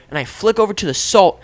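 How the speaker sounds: usual speaking voice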